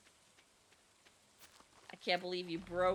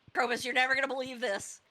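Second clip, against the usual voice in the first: excited; 4 semitones higher, 6 dB louder, faster than usual. Pretend. Calling to game character.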